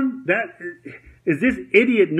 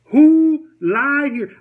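"Who lied here?" much higher and louder, slowed right down, hitting "who"; emphatic repeat; 9 semitones higher, 6 dB louder, much slower than usual. Shown as a demonstration. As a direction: drag it out slow and loud, leaning hard on "who". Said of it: "Now, who lied here?".

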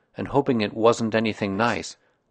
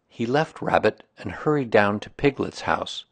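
ordinary delivery

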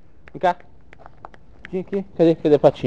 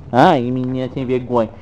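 ordinary talk

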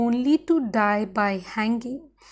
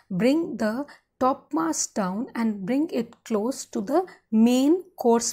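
ordinary delivery

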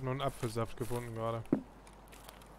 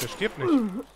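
disbelieving outburst; 11 semitones higher, 11 dB louder, much slower than usual. Real, not acted. Enemy won't die in a firefight.

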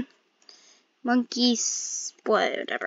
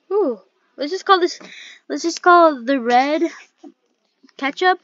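normal talking voice

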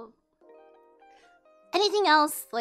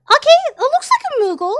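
high, loud, fast laughing words; 9 semitones higher, 10 dB louder, faster than usual. Real, not acted. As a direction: laugh it out in delight. Laughing at the finished moogle.

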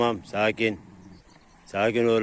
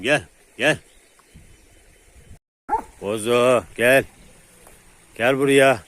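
loud, raised recall; calling dogs over; 3 semitones higher, 6 dB louder than usual. Real, not acted. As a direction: call out to a dog at a distance. (yüksek sesle köpek çağırma)

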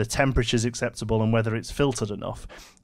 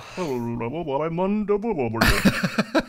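high, slow, gurgly fish voice; bubbly blubbing fish; 4 semitones higher, slower than usual. Pretend. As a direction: talk like a fish blowing bubbles underwater. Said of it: "That was my fish voice".

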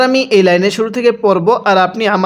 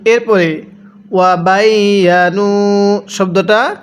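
normal talking voice

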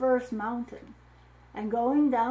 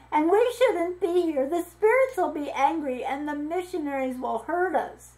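trembling; 5 semitones higher, 4 dB louder than usual. A narrator's reading.

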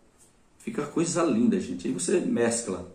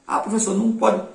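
a pupil's lightly voiced whiny protest, pitched higher; a light put-on student voice, higher than her own; mock student objection; 8 semitones higher than usual. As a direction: play a doubting student protesting to the teacher. Imagined student objects to teacher.

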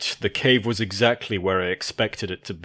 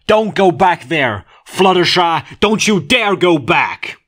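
desperate yell; 10 semitones higher, 9 dB louder than usual. Acted. Applejack yelling at friend.

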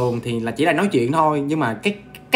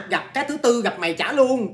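usual speaking voice

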